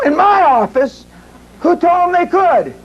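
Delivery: retold shock that they took his office; indignant surprise. Outraged at mafia in his office.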